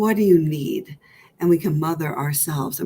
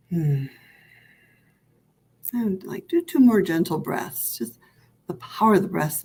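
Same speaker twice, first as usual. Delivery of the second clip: guided meditation. Acted. Leads breathing meditation.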